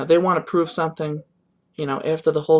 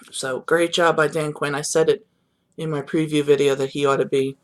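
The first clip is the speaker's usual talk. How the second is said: ordinary delivery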